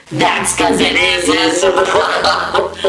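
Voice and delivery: deep, loud, slowed mock accusation; drunken blaming. Drunk girlfriend blaming boyfriend, deep loud drawl. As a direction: lay the blame on thick, slow and low.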